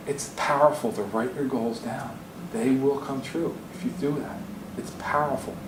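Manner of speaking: slow, soft and sincere; earnest. Real, not acted. Speaker tells audience to write goals down, slow and sincere. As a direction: let it sink in, slow and quiet. Earnest takeaway after the twins story.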